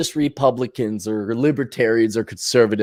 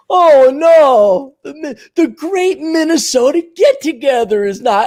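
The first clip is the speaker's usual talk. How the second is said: high mock lament, loud at first; distraught old-timer; 8 semitones higher, 5 dB louder than usual. Old-timer lamenting the cancelled state fair, high mock wail. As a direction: wail in hammed-up dismay. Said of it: "these old timers and these old farts".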